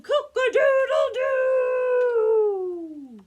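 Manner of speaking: long, loud, high crowing cock-a-doodle-doo; drawn-out hammed-up rooster call. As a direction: crow it out slowly like a proud rooster. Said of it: "the rooster crowed".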